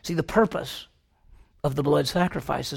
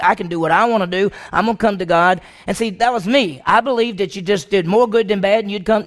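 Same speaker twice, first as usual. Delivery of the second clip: loud, raised preaching at full force; fervent preaching; 5 semitones higher, 7 dB louder than usual. Acted. Preacher pressing Cain's story on the church, loud and fervent. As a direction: drive the point home to the congregation.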